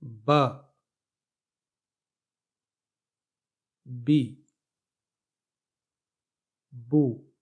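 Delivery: ب، بی، بو each said short and clear with one vowel; single vowel syllables. Shown as a demonstration. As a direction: sound out ب، بی، بو slowly like a teacher. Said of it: "یہ ایک حرکت کا column ہے". (ب، بی، بو کو ایک حرکت کے ساتھ صاف صاف ادا کرنا)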